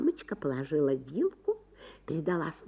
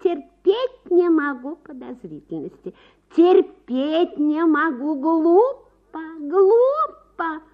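higher and louder, petulant; wife snapping at her suspicious husband; irritated wife; 11 semitones higher, 12 dB louder, slower than usual. A narrator's reading. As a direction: snap back, offended.

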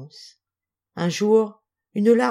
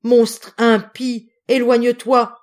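loud, high, horrified accusation; mounting maternal outrage; outraged cry; 5 semitones higher, 8 dB louder than usual. A narrator's reading. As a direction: cry out and drive the monster away. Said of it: "s'écria-t-elle en se relevant".

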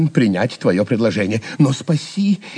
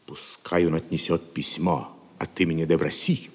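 whisper; 6 semitones lower, 5 dB quieter than usual. Acted. Plotting against the monk in secret.

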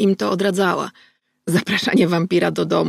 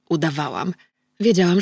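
plain reading voice

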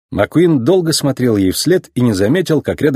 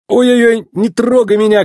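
high, louder yelp of protest; startled yell; 11 semitones higher, 4 dB louder than usual. A narrator's reading. Race car feels pushes from behind, startled yell. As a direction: cry out as if suddenly poked. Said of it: "крикнул он".

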